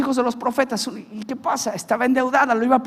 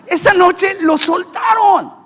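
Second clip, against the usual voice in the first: slow, loud and high, ringing out the victory; slow, loud preached proclamation; triumphant shout; 8 semitones higher, 9 dB louder, slower than usual. Real, not acted. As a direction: proclaim it like a preacher at full force. Climax of testimony.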